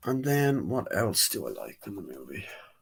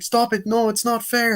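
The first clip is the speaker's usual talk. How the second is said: high-pitched, louder whine of injustice; mock child protest; 13 semitones higher, 4 dB louder than usual. Young [name] to the aunt who stopped her, protesting.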